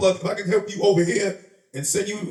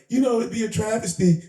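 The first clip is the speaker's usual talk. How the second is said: higher-pitched mimicked woman; imitating a woman friend; 5 semitones higher than usual. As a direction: put on her higher voice, mock-earnest. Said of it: "That's how she talks".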